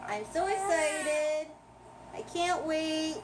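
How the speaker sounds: high, slow, stretched pet voice; voicing the pet. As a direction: put words in the pet's mouth sweetly. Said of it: "She says".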